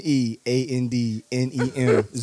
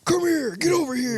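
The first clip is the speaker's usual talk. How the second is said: high-pitched game-character catchphrase; mock battle command, pitched way up; Scorpion; 10 semitones higher than usual. Podcaster showing co-hosts how the fighter talks, high mock command. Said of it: "'Cause Scorpion doesn't Scorpion saying".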